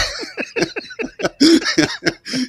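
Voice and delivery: high bursting laugh at the memory; big laugh. Real, not acted. Storyteller laughing at his old friend, a big high laugh.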